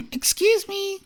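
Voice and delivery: high, tiny cricket voice; polite little insect interrupting the giraffe. A narrator's reading. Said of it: "coughed a cricket".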